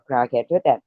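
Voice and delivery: ordinary talk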